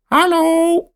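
very high squawking parrot. A narrator's reading. Parrot Polly squawks hallo, very high. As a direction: screech it like a parrot. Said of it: "roept Polly".

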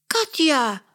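loud, high call of the name Katja. Acted. Mia calls out to Katja after the stamp, loud call. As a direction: shout for Katja across the room. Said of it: "roept Mia".

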